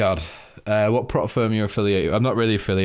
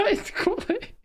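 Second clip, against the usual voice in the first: high-pitched, squeaky laughing speech; helpless laughter. Real, not acted. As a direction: squeeze the words out through real laughter. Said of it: "can't think of a straight face".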